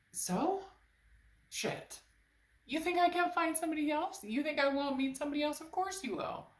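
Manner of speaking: high, sassy comeback; sassy retort. Acted. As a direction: toss it off with attitude. Universe voiced as sassy retort.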